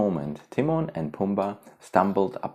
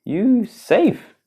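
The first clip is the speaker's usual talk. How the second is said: high anxious question; worried voice; 6 dB louder than usual. Acted. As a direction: ask it with worry. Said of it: "Pumbaa finally said".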